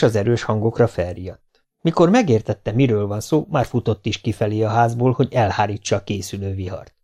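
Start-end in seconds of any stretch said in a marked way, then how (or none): none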